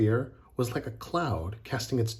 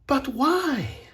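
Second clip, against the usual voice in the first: high, baffled question; astonished; 13 semitones higher than usual. A narrator's reading. Mirette asks Bellini for his reason, astonished. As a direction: ask it high-pitched, unable to believe it. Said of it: "was astonished".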